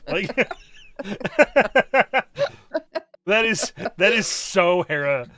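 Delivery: loud laughter then words in laughter; laughing. Real, not acted. Host cracking up at her friend, laughing.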